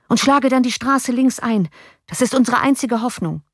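high, urgent shouted command; shouting. A narrator's reading. Johann still shouting directions to the coachman. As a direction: keep yelling the escape route up to the driver. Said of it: "schrie Johann dem Kutscher zu".

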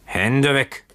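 high-pitched, narrated bellow at little extra volume; restrained roar. A narrator's reading. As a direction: warn him off with a contained yell. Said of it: "brüllte der Bootsmann ihn an". (verhaltenes Brüllen, hoch angesetzt)

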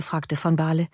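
usual speaking voice

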